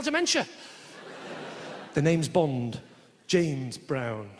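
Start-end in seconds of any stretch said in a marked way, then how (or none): 1.9-4.3 low, a hint of a suave Bond; lightly voiced Bond. Comic mocking James Bond's catchphrase, lightly lowered voice. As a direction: drop lower into a mock smooth spy line. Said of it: "I hate it when he says that".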